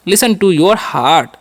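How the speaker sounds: "Listen to your heart" again slowly, stress on listen; slow repeat. Shown as a demonstration. Teacher repeating the English phrase slowly. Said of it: "ऐसे बोल सकते हैं".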